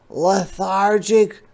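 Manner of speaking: high, slow, drawn-out sloth voice; unhurried sloth. Acted. Sloth voiced in read story.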